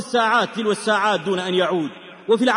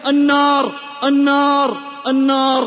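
mildly raised, drawn-out cry of delirium; delirious cry; 3 semitones higher than usual. A narrator's reading. Burned friend raving to his rescuer, mild delirious cry. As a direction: repeat it as a dying man raving. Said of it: "وأخذ يهذي".

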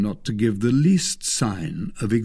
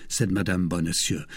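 ordinary delivery, nothing marked

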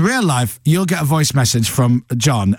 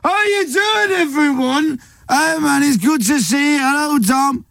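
much higher, bright booming Scouse greeting; John Bishop stage voice; 12 semitones higher, slower than usual. Retold or imitated. John Bishop bounding into the studio, high showman Scouse. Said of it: "Walk into the studio, goes".

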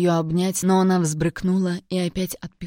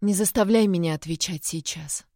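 plain reading voice

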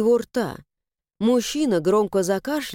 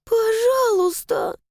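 high, slow, trembling plea; trembling whisper; 12 semitones higher, slower than usual. Acted. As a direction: beg with a shaking voice. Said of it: "прошептала она дрожащим голосом".

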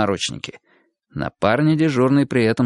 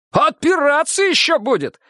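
high angry shout. A narrator's reading. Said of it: "крикнул Лёха".

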